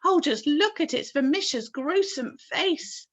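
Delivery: high loud horrified cry. A narrator's reading. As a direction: recoil in horror. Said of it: "cried the chief of the police".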